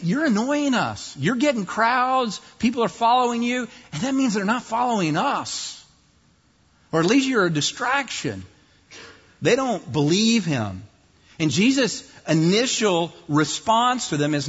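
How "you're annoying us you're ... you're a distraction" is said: higher, peevish complaint; annoyed leaders. Retold or imitated. Preacher voicing leaders complaining about Jesus's crowds, peevish. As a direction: grumble it with resentful sarcasm. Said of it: "if you can hear the sarcasm in their voice".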